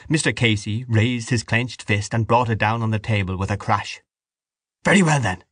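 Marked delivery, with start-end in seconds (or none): 4.8-5.4 higher, forceful retort; a contained angry shout; hoarse shout. A narrator's reading. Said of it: "he shouted hoarsely".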